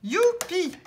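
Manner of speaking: bright, loud cheer of joy; excited cheer. Real, not acted. Vlogger cheering a winning scratch ticket, thrilled. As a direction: celebrate the win out loud.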